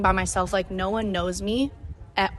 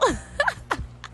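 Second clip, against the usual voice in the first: short high laugh; real high-pitched chuckle. Real, not acted. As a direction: laugh lightly at your own remark. Laughs at own remark.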